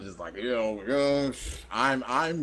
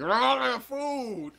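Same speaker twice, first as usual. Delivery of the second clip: high, slow pitiful plea; 11 semitones higher, slower than usual. Retold or imitated. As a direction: beg like a hungry stray, slowly. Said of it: "I'm like".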